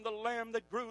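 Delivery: ordinary talk, nothing marked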